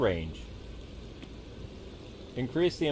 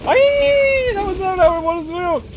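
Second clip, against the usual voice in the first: high, loud, fast panic; panicked screaming; 11 dB louder, much slower than usual. Real, not acted. Tiger attacks, panic.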